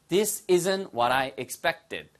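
very slow, clear model sentence; slow teacher demo. Shown as a demonstration. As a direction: spell it out slowly. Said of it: "발음 하나 주의하세요".

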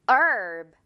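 herb. with no H, long "얼"; silent h. Shown as a demonstration. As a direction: drop the H and stretch the vowel. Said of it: "얼 발음 길게 끌어주세요". (H 묵음, 얼 길게 끌기)

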